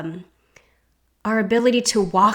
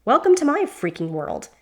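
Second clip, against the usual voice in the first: dry, slightly higher sarcastic retort; 5 semitones higher than usual. A narrator's reading. Diana answering the boar with sarcasm. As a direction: toss it off with sarcasm. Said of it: "Diana snaps".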